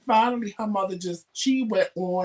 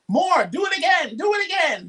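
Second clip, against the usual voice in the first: high, loud imitation of children begging for more; excited kids; 10 semitones higher, 4 dB louder than usual. Kids being picked up and thrown around beg, excitedly. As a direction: squeal the plea in an eager child's voice.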